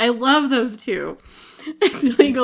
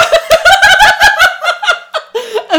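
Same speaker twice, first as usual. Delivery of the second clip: high burst of laughter; loud laugh; 8 dB louder than usual. Real, not acted.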